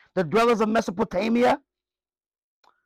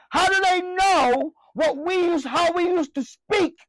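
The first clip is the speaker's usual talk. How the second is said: raised preaching question; 8 semitones higher than usual. Real, not acted. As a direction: demand it of the congregation. Preacher asks rhetorical question loudly.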